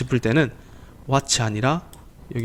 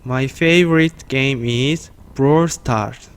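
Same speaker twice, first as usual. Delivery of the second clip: very slow, careful English; slow model answer; much slower than usual. Shown as a demonstration. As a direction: lay each word out slowly for learners. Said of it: "라고 질문했을 때".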